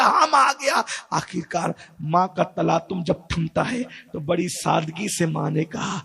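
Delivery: normal talking voice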